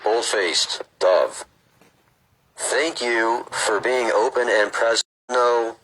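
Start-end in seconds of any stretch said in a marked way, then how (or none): 2.6-5.8 slow, measured read-aloud; book reading. Acted. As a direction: read it out deliberately, phrase by phrase.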